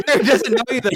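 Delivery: repeating the joke through a laugh; amused laughing repeat. Real, not acted. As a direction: laugh the words out.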